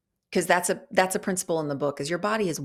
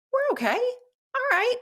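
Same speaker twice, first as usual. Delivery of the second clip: mock high reassurance to herself; self pep talk. Giving herself a pep talk, in a much higher voice. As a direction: lift it up high like cheering yourself on. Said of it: "I've given myself a pep talk".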